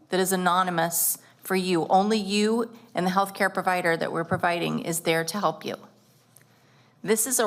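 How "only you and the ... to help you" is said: normal talking voice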